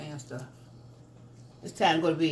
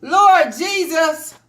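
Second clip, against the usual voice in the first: slow, drawn-out, louder exasperated cry; 8 semitones higher, 12 dB louder, much slower than usual. Real, not acted. Mom groaning to viewers about the dropped phone, drawn-out exasperation. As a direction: stretch it out in loud exasperation.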